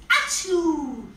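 loud sneeze. Acted. Somebody sneezes in story.

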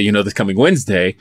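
normal talking voice

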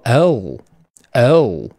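L said slowly, tongue curled back at the end; teacher drilling L slowly for owl; slow pronunciation demo. Shown as a demonstration. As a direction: model L slowly with a dark curled ending. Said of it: "后面都有个翘舌音".

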